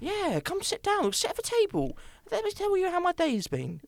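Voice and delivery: mock welcoming host. Host pretends to invite the listener in, high and sweet. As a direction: sweetly coax the listener to the table.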